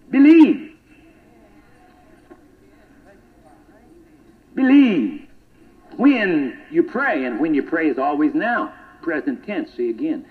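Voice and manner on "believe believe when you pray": loud, high cry of believe; full-throated pulpit cry; preacher's shout. Acted. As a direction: belt the word out to the congregation.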